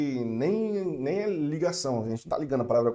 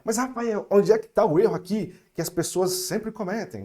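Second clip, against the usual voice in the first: louder and higher, a viewer asking; voicing a student; 6 semitones higher, 6 dB louder than usual. Student asking the teacher Rafael a question, louder. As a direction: play the curious viewer. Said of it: "você vai falar".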